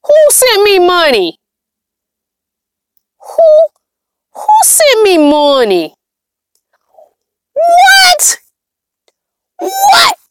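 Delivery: high, drawn-out squeal of disbelief; astonished. Real, not acted. Streamer finds someone sent her money, astonished. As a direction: shriek it in real shock, pitch shooting up. Surprised by an unexpected money gift.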